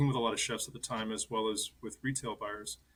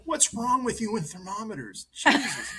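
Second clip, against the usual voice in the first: higher-pitched mock scolding; retold outburst of disbelief, pitched up; exasperated chef; 10 semitones higher, 4 dB louder than usual. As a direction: imitate an exasperated boss telling someone off. Retelling chef's outburst over broken thermometer.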